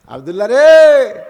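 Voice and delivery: calling out the name with a loud drawn "রে". Acted. Preacher calling to Abdullah in the story, loud cry. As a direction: cry out to him from afar.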